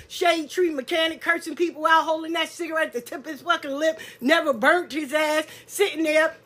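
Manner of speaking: ordinary talk, nothing marked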